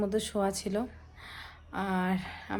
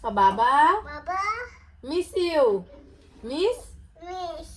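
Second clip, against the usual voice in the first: mother playfully coaxing her little son, high and sing-song; 8 semitones higher than usual. Real, not acted. Mother coaxing her small child.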